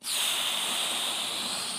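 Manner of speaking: slow audible breath out; deep relieving sigh; long calming exhale. Shown as a demonstration. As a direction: breathe out slowly to calm down. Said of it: "pause and be like".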